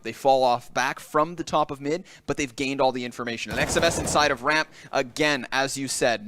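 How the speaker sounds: ordinary talk, nothing marked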